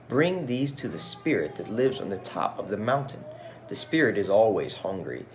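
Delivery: put-on storytelling voice for children; gentle read-aloud storyteller; story narration. A narrator's reading. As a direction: read it like a picture book aloud.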